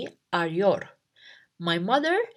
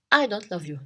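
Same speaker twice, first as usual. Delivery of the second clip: ordinary talk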